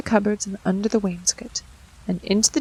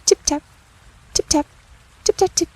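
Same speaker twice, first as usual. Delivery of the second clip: high, light tapping sounds; mouse tapping; 7 semitones higher, 3 dB louder than usual. Acted. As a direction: tap it out like tiny feet. Mice tapping from dresser.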